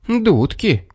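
high, quick refusal; defiant fox. A narrator's reading. Fox keeps the cap from bear, high defiance.

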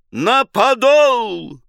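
high, loud, slow cry on подол; drawn-out shout. A narrator's reading. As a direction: stretch подол into a long yell. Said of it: "взвыл команду". (протяжный крик)

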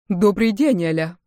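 usual speaking voice

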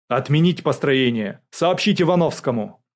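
loud, crisp string of commands; ordering. A narrator's reading. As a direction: issue orders to an officer. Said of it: "приказал генерал". (приказным тоном)

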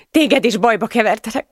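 high, distressed apology; tearful self-reproach. A narrator's reading. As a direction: confess it as if crying. Sobbing woman blames herself. (sírós, bűnbánó hang)

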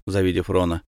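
ordinary delivery, nothing marked

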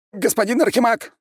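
very high frightened yelp; terrified squeal. A narrator's reading. Prisoner calls the archmage for rescue, squealing. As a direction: squeal for the boss's attention. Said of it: "взвизгнул Шустов".

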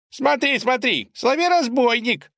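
put-on high excited child calling out; boy shouting. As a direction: call out like an eager boy. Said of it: "закричал какой-то мальчишка".

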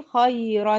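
"خيرا" as khayran; careful "خيرا" with the n sounded; demonstrating tanween. Shown as a demonstration. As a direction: end "خيرا" on a clear unvowelled n. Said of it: "نون ساكنة".

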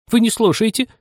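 very high, cross mouse voice; stern mouse. A narrator's reading. Mouse scolding inattentive Anya, sternly. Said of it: "грозно сказала мышь".

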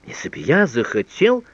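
пылкое восклицание. A narrator's reading. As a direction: exclaim it back to the beloved in amazement. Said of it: "воскликнул он". (пылко, удивлённо, повышая голос)

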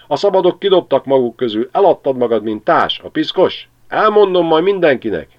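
high, loud, furious hawk; dühös karvaly. A narrator's reading. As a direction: spit the insults at the stork in rage. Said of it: "A karvaj dühösen zihált". (a karvaly dühösen rikácsol a gólyára)

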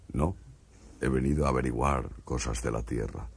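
calm the frightened man as an alien. Acted. Martian speaking to a frightened stutterer in a low alien voice.

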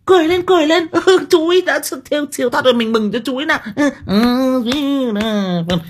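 very high, loud, singsong cheer; gushing temple lady. Retold or imitated. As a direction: gush over the death like a celebration.

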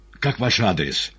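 ordinary delivery, nothing marked

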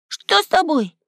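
high-pitched concerned cry; high boyish alarm. A narrator's reading. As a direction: rush to a friend with a high worried question. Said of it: "бросился к другу Максим".

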